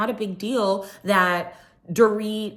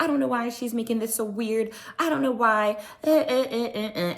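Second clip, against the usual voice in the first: higher-pitched mock complaint, trailing into wordless fussing; worked-up Kyle; 5 semitones higher than usual. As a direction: imitate someone getting mildly worked up. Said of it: "Kyle starts getting worked up".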